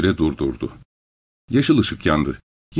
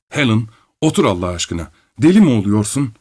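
ordinary delivery, nothing marked

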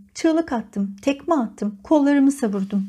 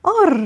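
loud, raised growl; 7 semitones higher, 5 dB louder than usual. A narrator's reading. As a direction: growl out in frustration, high and loud. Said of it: "hırıldama diyebileceğim bir ses". (yüksek sesli hırıltı)

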